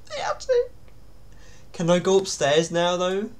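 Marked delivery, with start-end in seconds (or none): 0.1-0.7 dismayed. Real, not acted. Dreading the attic task.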